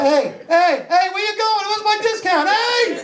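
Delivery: very high, mounting mock outrage; indignant calling out. Customer demanding his discount, indignant and high. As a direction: call after someone who is walking off. Calling after clerk who walks off.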